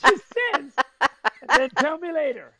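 can barely get the line out for laughing. Real, not acted. Husband retelling his wife's comeback, laughing hard.

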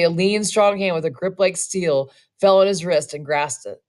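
plain reading voice

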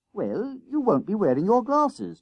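high-pitched schoolboy counter-argument; arguing. A narrator's reading. Jennings argues with friend over disguises, reasoning high. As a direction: lightly argue it back, boyishly insistent. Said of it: "Jennings argued".

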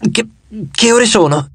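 slightly croaky, halting question; croaking. A narrator's reading. Just woken from a nightmare, croaking. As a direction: stumble on the first word, lightly croaky.

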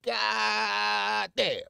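high, long-held holler of admiration; exclamatory shout. Real, not acted. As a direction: holler it, stretching the first syllable. Exclaims over Southern girls.

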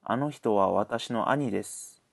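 ordinary talk, nothing marked

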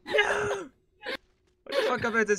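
high, loud panicked shout; scared horror game streamer. Real, not acted. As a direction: scream in real panic. Dying in game fight.

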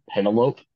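Penelope said as PEN-uh-lope; mistaken pronunciation. Shown as a demonstration. Confessing a reading mistake, Penelope mispronounced as PEN-uh-lope. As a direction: say "Penelope" in three syllables, rhyming with "lope". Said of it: "I thought Odysseus's wife was named".

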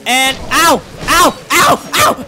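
loud, high ow after ow; repeated yelps of pain. Real, not acted. Gamer taking hit after hit, loud pained yelps. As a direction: yelp with every blow.

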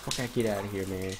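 ordinary delivery

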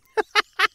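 hohes, kurzes Auflachen; über den eigenen Versprecher loslachen; echtes Lachen. Real, not acted. (hohes Lachen)

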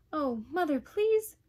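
put-on high, loud boyish plea; beg, rising sharply on please; pleading. Boy begs mother to help, pleading.

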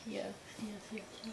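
barely audible, mumbled yeses; too quiet. Real, not acted. Little brother too scared to speak up, near-silent. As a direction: answer under your breath, very softly. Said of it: "Talk louder. They can hear you".

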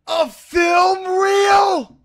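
very slow, high, drawn-out disbelief; incredulous yell. Real, not acted. Player discovering the crate's contents, incredulous yell. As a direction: stretch it into a high wail. Learns what the crate holds.

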